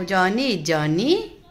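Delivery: put-on drawn-out, very slow jo-nee jo-nee for the child; slow prompting. Shown as a demonstration. Mother coaxing her son to recite, very slow prompt. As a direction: stretch out Johnny Johnny slowly so the toddler can copy it. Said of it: "तू म्हणून दाखव".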